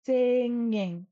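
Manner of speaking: "singing" drawn out on the ng; emphasized NG. Shown as a demonstration. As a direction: hold the middle NG of "singing" long. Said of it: "Really put an emphasis on that N G".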